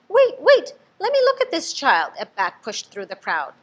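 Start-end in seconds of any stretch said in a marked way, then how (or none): 0.1-2.1 high, loud, pressing in; urgent mother bat. A narrator's reading. Mother bat pushes through the crowd, high and urgent. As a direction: push through the crowd to see.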